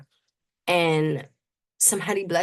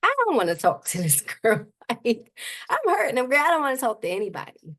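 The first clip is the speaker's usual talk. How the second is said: higher, half-laughing complaint that she won't talk to anyone; reluctant, hurting refusal; 5 semitones higher than usual. Retold or imitated. Telling her mentor early on she didn't want to talk. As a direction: push the mentor away, raised pitch and a laugh. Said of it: "I was like".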